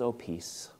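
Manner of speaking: soft, tender voice of Jesus greeting his friends; gentle Jesus. Acted. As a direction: breathe the peace out softly. Jesus comes and speaks.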